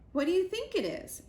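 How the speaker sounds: high chick voice. A narrator's reading. Dirt asks Moosh.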